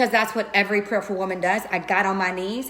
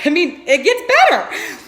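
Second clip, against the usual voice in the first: laughing and louder through the line; 6 semitones higher, 5 dB louder than usual. Real, not acted. Teasing her listeners that it gets better, laughing. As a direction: let the laugh break into the teaser.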